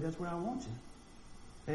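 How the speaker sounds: soft, slow and hushed, as God's gentle answer. Retold or imitated. Preacher voicing God's quiet call to ministry, soft and slow. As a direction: say it quietly, like God settling the matter.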